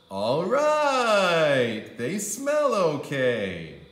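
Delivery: a drawn-out reluctant okay; grudging approval. A narrator's reading. Andrew testing the pajamas, grudging drawn-out approval.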